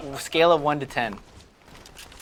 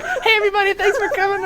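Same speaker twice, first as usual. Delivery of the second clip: same hoarse, squeaky hero voice; Bruce Wayne; 4 dB louder than usual. Acted. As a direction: greet guests in a comically strained croak. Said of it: "Bruce Wayne's like".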